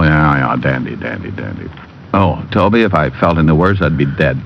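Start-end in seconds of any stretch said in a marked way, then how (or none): 0.0-1.7 groggy words trailing away to nothing; fading groggy mumble; dazed and fading. Acted. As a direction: drift off, still half knocked out. Dazed after beating.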